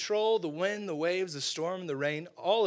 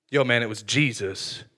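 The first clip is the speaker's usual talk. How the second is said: glum reply; 7 semitones lower, 6 dB louder than usual. Acted. Second disciple answers glumly.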